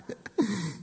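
helpless chuckle before the story; laugh, unable to hold it in; amused laugh. Real, not acted. Preacher bursting to share an anecdote, amused laugh.

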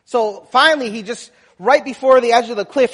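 ordinary talk

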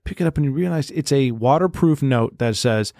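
ordinary talk, nothing marked

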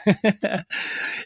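a quick, high self-conscious laugh; short laugh. Real, not acted. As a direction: laugh at your own stumble. Laughs at own stumbling.

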